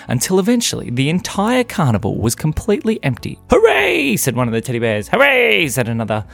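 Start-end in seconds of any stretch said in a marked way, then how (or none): 3.5-4.2 high, loud hooray; triumphant cheer. Acted. Bear cheers empty carnival. 5.1-5.7 high, loud falling hooray; jubilant cheer. Acted. Another bear cheers.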